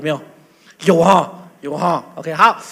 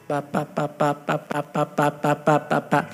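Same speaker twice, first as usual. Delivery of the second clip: put-on low repeated 爸爸 babble like a toddler; demonstrating tongues as a toddler's 爸爸; baby babble tongues; 6 semitones lower, 4 dB quieter than usual. Shown as a demonstration. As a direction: babble 爸爸 over and over like a child learning to talk. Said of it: "要么是叫爸爸".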